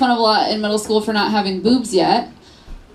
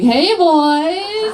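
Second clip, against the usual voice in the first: high, drawn-out come-on; showy flirting; 8 semitones higher, 4 dB louder than usual.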